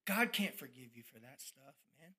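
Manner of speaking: a low, soft voice in a different tone, sneering; the doubting voice on his shoulder, low and soft; tempting inner voice. Retold or imitated. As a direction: murmur it low like the devil on your shoulder. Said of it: "that other shoulder talking to me saying".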